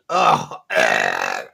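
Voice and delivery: loud retching groan of disgust. Real, not acted. Host reacting to a gross chat message, disgusted groan. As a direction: groan as if about to gag.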